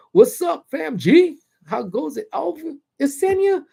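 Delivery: high, loud chirpy gadget voice; soundbar greeting voice. Retold or imitated. Scene: soundbar greets its owner by name, high chirpy voice. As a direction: play the soundbar greeting you. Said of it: "soundbars always go".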